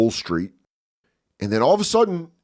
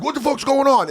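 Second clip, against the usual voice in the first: high, raised voice demanding answers; mock alarm, pitched way up; startled stepdad; 4 dB louder than usual. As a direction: imitate a startled stepdad calling out. Acting out stepdad's startled question.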